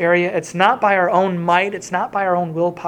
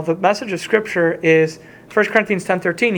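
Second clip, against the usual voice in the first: ordinary talk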